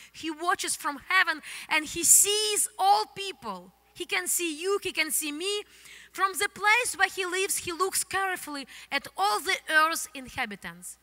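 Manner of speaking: ordinary delivery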